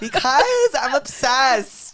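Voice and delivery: high, loud, drawn-out fan gush; gushing obsession. Real, not acted. Host confessing fandom to the singer, gushing.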